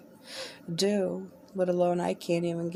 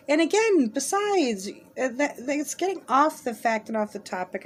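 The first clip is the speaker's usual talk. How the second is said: exasperated; 7 semitones higher, 5 dB louder than usual. Real, not acted. Frustrated complaint, voice rising.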